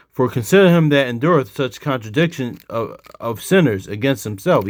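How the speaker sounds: scripture reading. Acted. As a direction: read the verse out forcefully. Reads scripture aloud to teach.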